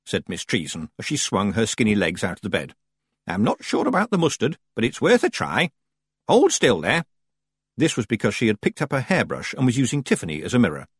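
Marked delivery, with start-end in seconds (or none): 3.3-7.1 put-on old witch voice. Old witch Miss Treason speaking.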